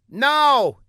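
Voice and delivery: high, loud snapped Now; comic outburst. A narrator's reading. Older man yells at Fla, high and loud. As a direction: bark it out in mock fury. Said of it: "expostulated the man".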